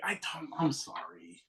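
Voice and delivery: slow, whispered retold apology; hushed, drawn-out mock contrition; sheepish apology. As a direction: whisper it, embarrassed. Sheepish apology for not recognizing.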